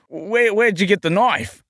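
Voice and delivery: high, animated Aussie film-quote voice; hammed-up Australian accent. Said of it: "I have to get Australian".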